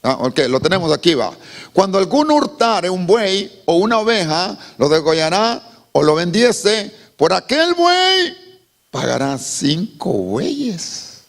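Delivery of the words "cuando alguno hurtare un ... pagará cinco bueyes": scripture reading. Acted. Reads scripture aloud.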